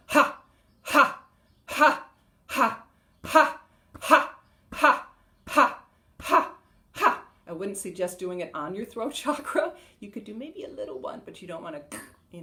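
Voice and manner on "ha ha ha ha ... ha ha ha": loud, high "ha" shouts, spaced apart; slower yelled ha. Shown as a demonstration. Showing the slower version of the exercise, spaced yelled ha. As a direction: yell each ha separately at an unhurried beat. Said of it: "Or you can do it slower".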